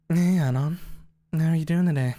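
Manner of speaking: Fang voice. Pretend. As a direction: greet the chat in a mildly performed character voice. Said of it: "I could try to do the voice".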